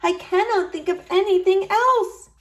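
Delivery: wife craving the salad, pleading; longing wife. A narrator's reading. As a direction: yearn for it aloud. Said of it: "she said".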